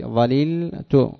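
"ظللت" very slowly, drawn out in parts; syllable by syllable. Shown as a demonstration. Teacher showing students the verb with its pronoun, syllable by syllable. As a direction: stretch "ظللت" out, pausing before the final syllable.

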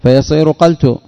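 teacher landing on the correct form; stress on قلت. Shown as a demonstration. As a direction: lift and mark قلت with u on the qaf. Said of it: "تحرك الفاء بالضم".

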